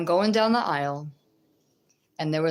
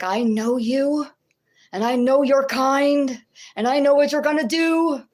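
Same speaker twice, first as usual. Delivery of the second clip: menacing possessed woman; 7 semitones higher, 6 dB louder than usual. Retold or imitated. Retelling a shaking woman who claimed to know her, loud and eerie. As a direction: voice the stranger's eerie, rising threat.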